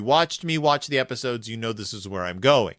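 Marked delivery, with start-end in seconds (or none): none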